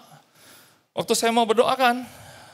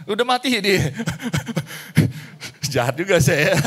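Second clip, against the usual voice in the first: laughing. Real, not acted. Laughing telling the man died.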